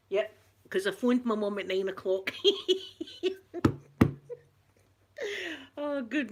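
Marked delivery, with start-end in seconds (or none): 2.4-3.8 high laugh. Real, not acted. Laughing about phoning mum.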